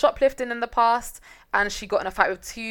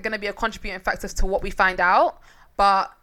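ordinary delivery

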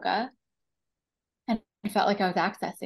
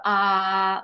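sustained open "aah" at raised volume; loud, long "ah"; 7 dB louder than usual. Shown as a demonstration. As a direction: demonstrate a long sounded release. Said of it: "like, really loud, really long".

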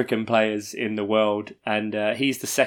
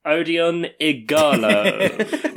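laughing; 5 semitones higher, much slower than usual. Real, not acted. Announcing a laughable signing.

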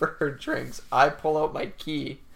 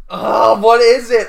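loud, high exasperated cry; straining to remember a song, loud and high; frustrated outburst; 9 semitones higher, 15 dB louder than usual. Real, not acted. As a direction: shout at your own memory. Frustrated trying to recall song.

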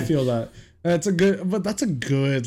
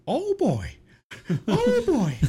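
very high cartoon mouse voice; squeaky high Mickey Mouse; slower than usual. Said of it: "it's fucking Mickey Mouse's".

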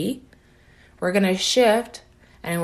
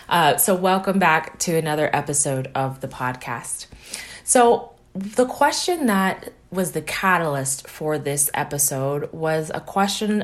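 ordinary talk, nothing marked

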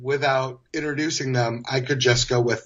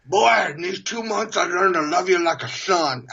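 higher, muffled mock manager voice; cotton-ball-cheeked boss; 4 semitones higher than usual. General manager to young salesman, mouth stuffed with cotton. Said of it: "spoke like he had cotton balls in his cheeks".

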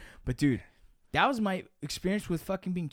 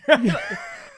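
loud laugh; 5 semitones higher, 12 dB louder than usual. Real, not acted. Laughing at his own panic while telling friends.

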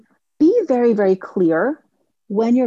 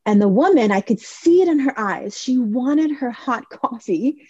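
normal talking voice

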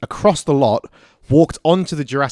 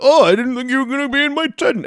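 much higher, fluttery surprised voice; flustered mock voice; 13 semitones higher, 4 dB louder than usual. Mocking Alan Grant surprised to see Ellie, high-pitched. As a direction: play it caught off guard.

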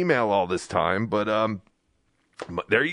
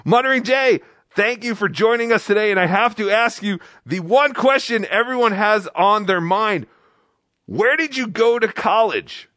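high, hyped-up talk show host; mock-thrilled interviewer; 7 semitones higher, 3 dB louder than usual. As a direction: gush over the guest with hammed-up excitement. Hyped mock interview of fake guest.

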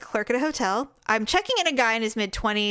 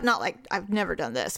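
ordinary talk

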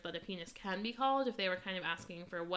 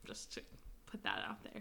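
whisper; 13 semitones lower, 10 dB quieter than usual. Real, not acted. Sneaking in a crude joke.

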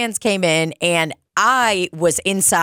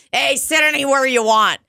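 friendly bartender greeting; 8 semitones higher than usual. Retold or imitated. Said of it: "and I told them".

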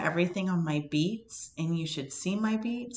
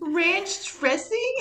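high, slow disbelieving question; baffled, drawn-out ranch dressing; a friend baffled by the ranch remark; incredulous; 11 semitones higher, 3 dB louder, much slower than usual. Real, not acted.

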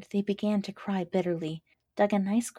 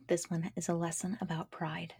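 whispered teasing warning; whispered aside; 6 dB quieter than usual. Real, not acted. Host warning listeners, whispered aside. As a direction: lean in and whisper it.

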